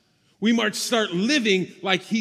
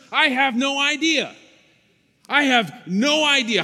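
louder, higher exclamation of not knowing; raised-voice, candid confession; emphatic admission; 5 semitones higher, 6 dB louder than usual. Real, not acted. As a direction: throw it out emphatically to the congregation.

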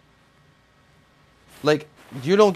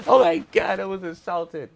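mock outraged victim; 4 semitones higher than usual. Mocking Tommy after a drink gets thrown on her, mock outrage.

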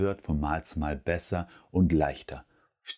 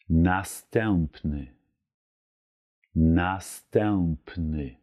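Następny drawn out very slowly; very slow demo; much slower than usual. Shown as a demonstration. Teacher slowly models następny. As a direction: stretch each syllable of Następny. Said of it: "heißt auf Polnisch".

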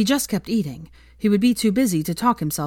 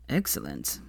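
very low and soft, smug; a satisfied low purr; 14 semitones lower, 7 dB quieter than usual. A narrator's reading. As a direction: savour it smugly. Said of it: "he said smugly".

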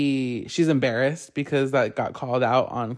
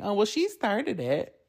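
mock childish excuse; 5 semitones higher, 5 dB quieter than usual. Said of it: "Using that as an excuse".

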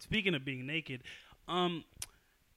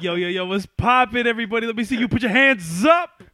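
loud hype MC shout; hype rapper; 6 semitones higher, 14 dB louder than usual. Retold or imitated. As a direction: yell it like a rapper hyping a crowd. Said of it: "holding it like a rapper".

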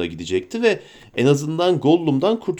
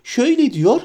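much higher eager creature voice; Gollum voice; 8 semitones higher, 3 dB louder than usual. Acted. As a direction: wheedle and whine at your master. Gollum keeps persuading.

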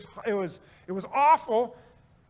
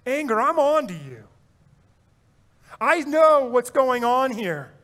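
defiant; 3 semitones higher, 4 dB louder than usual. Pretend. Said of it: "be humble enough to say".

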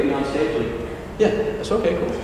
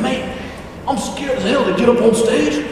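a deliberately bad Australian accent, pitched higher and climbing; over-the-top mock Australian accent, going higher; 8 semitones higher than usual. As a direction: ham up an Aussie mate's voice, badly. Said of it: "that's a terrible, uh, impression".

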